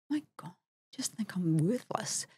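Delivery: hushed, breathless inner thought; whispered despair. Retold or imitated. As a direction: whisper it as if to yourself.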